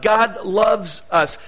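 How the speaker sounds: slow, deliberate "God loves us"; slow sermon statement; slow declaration. Real, not acted. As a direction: lay each word down slowly.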